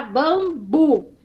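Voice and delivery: BAMBU in two separate beats, bam... bu; split into syllables. Shown as a demonstration. Teacher demonstrating two-syllable words for a child, split into syllables. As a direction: divide "bambu" clearly into its syllables. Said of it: "a criança vai dividir".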